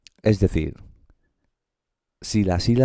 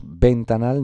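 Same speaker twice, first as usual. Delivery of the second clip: ventanal said as VEN-ta-nal; wrong stress on VEN; 3 semitones higher, slower than usual. Shown as a demonstration. Teacher rules out a wrong option, ventanal stressed as VEN-ta-nal. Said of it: "no, ventanal".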